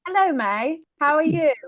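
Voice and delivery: trying on a British accent; put-on British greeting. Shown as a demonstration. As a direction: attempt a polite British accent. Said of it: "let me try my British accent".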